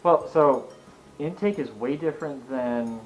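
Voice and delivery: usual speaking voice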